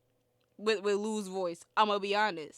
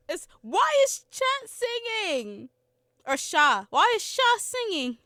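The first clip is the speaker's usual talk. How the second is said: incredulous; 9 semitones higher, 5 dB louder than usual. Real, not acted. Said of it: "laughing disbelief".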